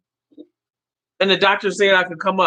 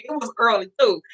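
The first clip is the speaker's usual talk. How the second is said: ordinary talk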